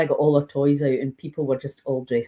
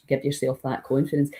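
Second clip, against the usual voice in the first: normal talking voice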